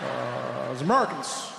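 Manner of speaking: halting voice that seems to be buffering; Barack Obama. Acted. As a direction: stall on long uhs and stretched words. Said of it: "sounded like his voice was buffering".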